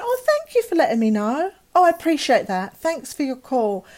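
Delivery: high, sing-song polite phone thanks; imitating overly polite gratitude; forced politeness. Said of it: "I knew I couldn't annoy him".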